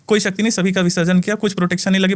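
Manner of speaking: normal talking voice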